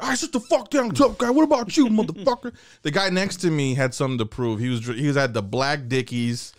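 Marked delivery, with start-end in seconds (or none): 0.0-2.5 inmate bully. Retold or imitated. Inmate interrogating kids.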